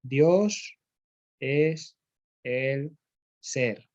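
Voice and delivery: slow dictation, pausing after each word. Shown as a demonstration. Teacher dictating to students taking notes, word by word. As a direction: give it one word at a time so they can copy it down. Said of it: "Apuntad, en grande".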